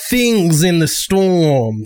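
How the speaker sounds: slow, stretched-out ominous words; ominous, drawn out. Acted. Survivor reporting what people saw in the storm, slow and ominous. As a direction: draw each word out for suspense. Ominous warning about sightings.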